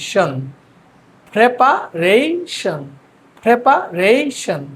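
প্রিপারেশন very slowly as prepa, rei, shon; syllable-by-syllable pronunciation. Shown as a demonstration. Teacher models for students to repeat, প্রিপারেশন slowly in pieces. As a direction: stretch and lift the middle of প্রিপারেশন, pausing between chunks. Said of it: "দেখেন, বলেন".

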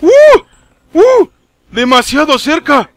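high, loud startled cries; startled yelp. Real, not acted. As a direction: yelp as shots land close. Disparos cercanos, sobresalto. (grito de sobresalto)

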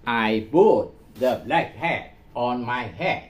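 slow, careful imitation of the machine read; slow repeat-after. Shown as a demonstration. Teacher repeats Google's sentence for students, slow and careful. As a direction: copy it word by word, slowly. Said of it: "Google Translate".

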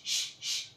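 ش، ش، as a long hushed unvoiced sh; sh sound. Shown as a demonstration. Teacher modelling the ش sound for pupils. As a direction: hiss out ش، ش، softly with no voice. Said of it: "بتبقى ش".

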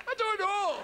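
high surprised reply. Retold or imitated. Answering the newsreader on TV, high and startled.

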